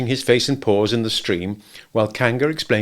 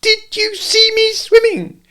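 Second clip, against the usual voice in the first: very high, slow excited squeak; 6 dB louder, slower than usual. A narrator's reading. Roo boasting after climbing out, excited squeak. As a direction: squeak with pride. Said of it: "squeaked Roo excitedly".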